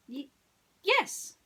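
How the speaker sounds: timid squeaky stutter; shy student stammering. Acted. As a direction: stammer out a nervous yes. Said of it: "the boy stammered".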